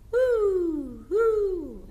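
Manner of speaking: high, loud hooting owl. Shown as a demonstration. Teacher shows the class an owl's hoot.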